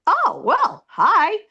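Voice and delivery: high, lilting ghost greeting. Retold or imitated. Ghost appearing to the narrator, greeting in a high voice. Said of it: "he showed himself to me and goes".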